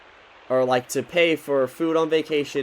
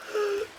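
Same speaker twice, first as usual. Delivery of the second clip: excited gasp; 6 dB quieter than usual. Real, not acted. Reacts to fish gift.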